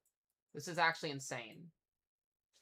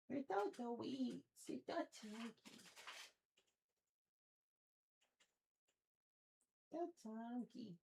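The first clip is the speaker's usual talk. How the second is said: high, soft and slow cooing; higher, softer, slow pet voice; 7 semitones higher, 4 dB quieter, slower than usual. Real, not acted. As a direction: coo at her tenderly. Cooing softly to cat.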